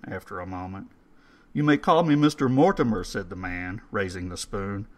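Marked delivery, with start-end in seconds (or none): 1.5-3.0 put-on higher, louder, quick formal old man. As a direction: announce yourself grandly.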